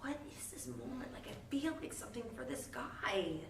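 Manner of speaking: soft, whispered inner thoughts; hushed wonder. Retold or imitated. Elphaba wonders about her feelings, whispered. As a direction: confide it to yourself. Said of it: "she's like".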